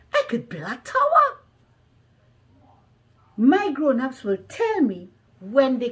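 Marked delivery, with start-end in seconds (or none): 0.1-1.3 high, fast, louder burst of excitement; excited child. Acted. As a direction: blurt it out like a thrilled kid. Child narrator excited by play ideas.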